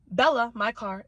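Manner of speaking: ordinary talk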